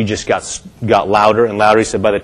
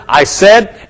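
higher, emphatic insistence; bolder; 6 semitones higher than usual. Acted. Believers speaking when it looks failed, bold declaration. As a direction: assert it boldly against appearances. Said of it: "we say it even bolder".

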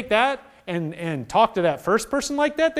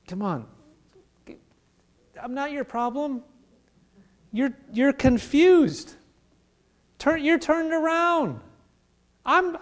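tender fatherly voice; 6 semitones higher than usual. Retold or imitated. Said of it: "He's just like".

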